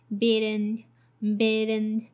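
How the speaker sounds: "bidden" with B and in run together; linked bidden. Shown as a demonstration. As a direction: glue B onto in: "bidden". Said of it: "So link B and in together".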